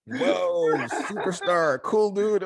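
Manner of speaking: high, loud star-struck gushing; awestruck locals. Retold or imitated. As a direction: gush like you've spotted a celebrity. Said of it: "when they saw me, I was like".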